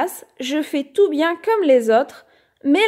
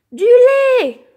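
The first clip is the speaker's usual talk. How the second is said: crying out; 9 semitones higher, 8 dB louder than usual. A narrator's reading. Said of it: "je crie".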